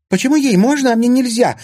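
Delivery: very high, loud indignant screech; outraged. A narrator's reading. Zvereva left out in the corridor, outraged. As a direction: screech at the unfairness. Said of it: "возмутилась Зверева".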